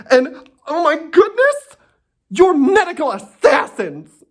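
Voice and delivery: high, slow, hammed-up gasping outrage; mock horror, high and drawn out; mock clutching pearls. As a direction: play the horrified shut-in. Mocking Sequoia's fearful outrage.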